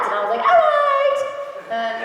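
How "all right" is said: high, loud, eager "All right"; upbeat agreement, pitched way up; Lux voice. Retold or imitated. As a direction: fire it off high like a cheerful heroine. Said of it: "that was Lux".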